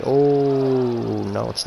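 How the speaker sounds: sudden dismayed oh; surprised. Real, not acted. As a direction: caught off guard. Ball kicks off fairway.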